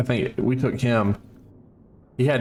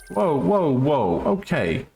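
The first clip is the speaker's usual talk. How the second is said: surprised whoa; 6 semitones higher, slower than usual. Real, not acted. Startled by the game.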